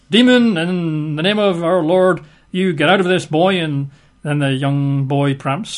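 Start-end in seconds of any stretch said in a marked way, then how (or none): 0.1-3.6 hammed-up exorcist. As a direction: play the pompous exorcist. Disciple commands the demon, imitated.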